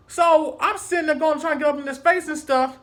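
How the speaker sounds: usual speaking voice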